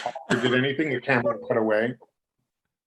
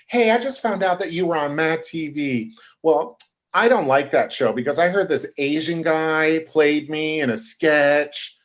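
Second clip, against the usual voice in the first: higher imitated indignant complaint; 4 semitones higher, 3 dB louder than usual. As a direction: complain in a raised, put-on voice. Voicing [name] confronting him.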